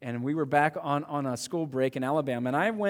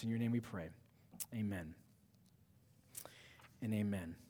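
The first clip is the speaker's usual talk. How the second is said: even, lower-key pastoral prayer; 3 semitones lower, 4 dB quieter than usual. Real, not acted. Pastor prays to Jesus before the message, subdued prayer. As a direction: pray on behalf of the congregation, settling softly into the amen.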